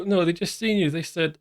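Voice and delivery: usual speaking voice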